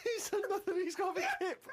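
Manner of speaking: very high squeak through laughter; cracking up. Real, not acted. Host joining in the mockery of the diarist, cracking up. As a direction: barely get the words out for laughing.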